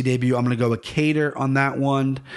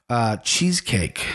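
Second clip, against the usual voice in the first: usual speaking voice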